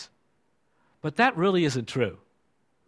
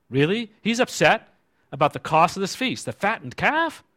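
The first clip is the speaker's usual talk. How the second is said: high, mocking disbelief; incredulous; 6 semitones higher than usual. Preacher mocking the son's complaint, incredulous. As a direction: raise the pitch in mock astonishment.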